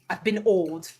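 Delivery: ordinary delivery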